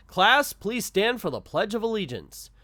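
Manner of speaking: high, loud classroom announcement; teacher calling out. Acted. Teacher calling the class to stand, loud announcing voice. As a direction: project it to a whole class.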